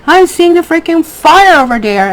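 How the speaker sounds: incredulous mock shout; exasperated outburst. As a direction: blurt it out like you can't believe they miss it. Exasperated quote of herself.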